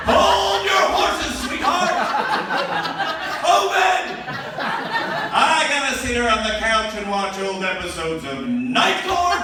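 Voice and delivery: high, loud hammed-up protest; mock panic. To mother-in-law, high loud hammed-up covid excuse. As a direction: shout the excuse like a crisis. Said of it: "And I'm like".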